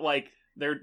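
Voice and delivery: ordinary talk, nothing marked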